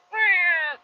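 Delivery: high, small cub roar; Simba. Retold or imitated. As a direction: attempt a roar and let out a feeble squeal. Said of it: "whenever Simba's like".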